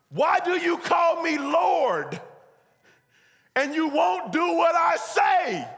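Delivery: high, shouted sermon delivery; full-force preaching. Acted. Preacher driving a verse and story home to congregation, full-force shout. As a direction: thunder it out to the whole church.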